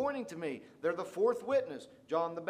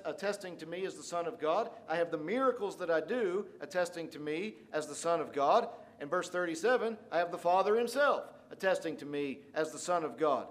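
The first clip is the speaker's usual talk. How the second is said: normal talking voice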